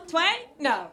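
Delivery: ordinary talk, nothing marked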